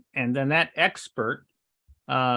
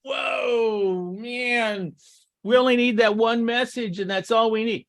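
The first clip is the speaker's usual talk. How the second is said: higher-pitched, drawn-out mock awe; mock amazement; 6 semitones higher than usual. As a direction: play a naively wowed client. Mimics naive awe at one message.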